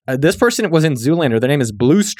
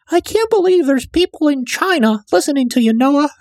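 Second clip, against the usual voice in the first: much higher, squeaky mom impression; 13 semitones higher than usual. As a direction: play an amazed mom in falsetto. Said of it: "my mom doesn't sound like that".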